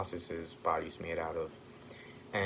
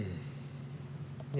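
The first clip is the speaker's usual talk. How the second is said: ordinary delivery, nothing marked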